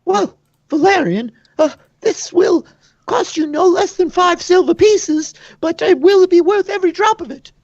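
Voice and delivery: high, quick, marvelling tavern woman; excited barkeep. Acted. Tavern keeper greets a stranger, high and amazed. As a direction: gush over the new customer in a high voice. GM voices Betty.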